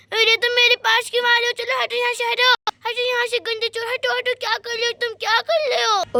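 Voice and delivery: put-on child's voice warding someone off; frightened little girl. Sonia to the approaching thief, alarmed protest.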